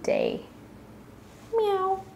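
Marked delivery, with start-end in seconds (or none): 1.6-2.0 high, short kitten meow; high-pitched imitated meow; cat meow. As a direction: mew like a little cat.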